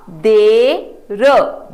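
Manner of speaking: drawn-out देर, each sound stretched; slow model reading. Shown as a demonstration. Teacher to children, modelling the word slowly. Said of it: "आओ अगला शब्द पढ़ें".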